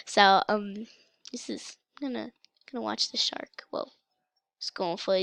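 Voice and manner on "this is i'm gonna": normal talking voice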